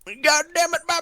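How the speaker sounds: high exasperated shout at a teammate; frustrated high yell. Real, not acted. Teammate gets the readback wrong.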